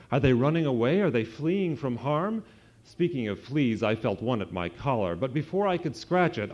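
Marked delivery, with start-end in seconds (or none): 0.1-2.4 alarmed. A narrator's reading. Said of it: "Chester asked with alarm".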